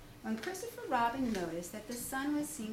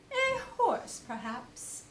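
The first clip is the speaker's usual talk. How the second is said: Owl suggesting; 10 semitones higher than usual. Acted. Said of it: "said Owl".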